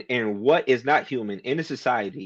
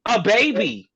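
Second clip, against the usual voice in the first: high-pitched, excited mock cheering; exaggerated, high-pitched delight; happy congratulating; 7 semitones higher, 3 dB louder, slower than usual. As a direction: act out everyone celebrating the news. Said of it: "she's happy about it, everyone's like".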